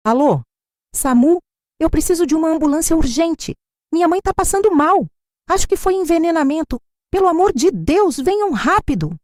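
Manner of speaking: high, breathless pleading; desperate phone call. Acted. Daughter begs the ambulance to come fast, high desperate pleading. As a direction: beg the dispatcher to hurry.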